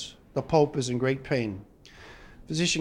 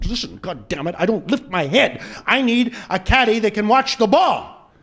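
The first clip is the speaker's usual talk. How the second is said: exasperated golfer, building louder and higher; hammed-up irate outburst; mock angry scolding; 5 semitones higher, 5 dB louder than usual. Acted angry golfer scolds young caddy.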